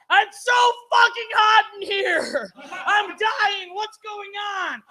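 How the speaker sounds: high, drawn-out frantic wail; hammed-up meltdown, slow and high; losing control. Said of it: "then I completely lost control".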